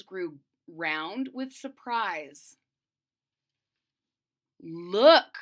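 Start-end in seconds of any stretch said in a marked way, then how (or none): 4.6-5.4 loud excited shout. A narrator's reading. Wife shouting in surprise at the pot. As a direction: call it out loud. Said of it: "she shouted".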